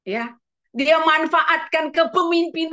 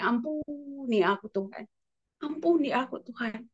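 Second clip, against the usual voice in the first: much softer, fading prayer; repentant plea; 7 dB quieter than usual. Acted. The king begs God for mercy, soft repentant plea. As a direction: beg forgiveness under the breath.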